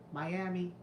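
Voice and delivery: usual speaking voice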